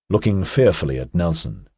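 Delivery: plain reading voice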